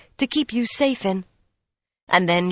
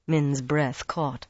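usual speaking voice

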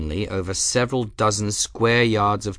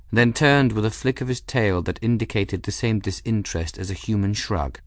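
usual speaking voice

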